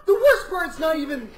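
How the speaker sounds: high-pitched, helpless laughing through the words; laughing complaint. Real, not acted. Drinker describing the drink to the others, laughing complaint.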